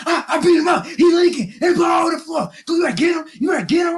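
high, loud boastful yell; bragging shout. Retold or imitated. Kevin to the guards, shouting his brag. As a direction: crow about the win at full voice.

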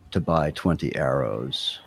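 ordinary talk, nothing marked

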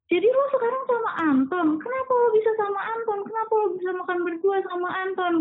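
retold angry accusing. Jealous boyfriend accusing her about Anton, retold high-pitched anger. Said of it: "Dia marah-marah".

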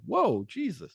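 high startled whoa, imitating himself; mock surprise. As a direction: throw it up high like you're caught off guard. Retelling own reaction to film.

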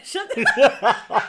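words half lost in laughter; laughing. Real, not acted. Laughing at cohost's joke.

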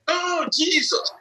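slow, exaggerated "oh" of disbelief; mock disbelief. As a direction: act scandalised at somebody's lie. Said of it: "when somebody is telling lies".

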